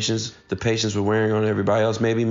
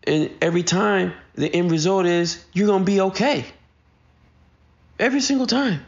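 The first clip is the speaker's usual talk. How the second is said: higher-pitched mock scolding, climbing at the end; exasperated parent; 6 semitones higher than usual. Parents to daughter who freaked out over nothing, exasperated. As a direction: replay a parent's worked-up lecture, pitch raised throughout.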